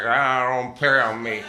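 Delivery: put-on slow "don't pick on me" in a Philly accent. Imitating friend Keith's Philly accent, slow and whiny. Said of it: "That's just his accent".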